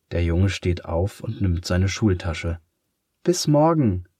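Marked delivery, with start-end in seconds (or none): 3.2-4.1 high, bright goodbye called out; calling out. A narrator's reading. Girl calls goodbye to the leaving boy, high and called out. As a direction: call after him across the room. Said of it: "ruft Pia".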